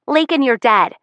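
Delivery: put-on high, loud boyish call; a young boy's playful shout; kid brother shouting. A narrator's reading. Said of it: "Kel shouts through the open window".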